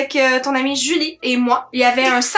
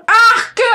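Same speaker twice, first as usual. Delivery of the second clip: loud gag; retching out loud; dry heaving; 8 semitones higher, 7 dB louder than usual. Real, not acted. Gagging on dead fish smoothie.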